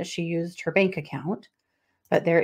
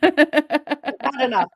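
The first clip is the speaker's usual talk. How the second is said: sleepy dog voice; 7 semitones higher, 5 dB louder, much slower than usual. Pretend. Voicing the tired dogs.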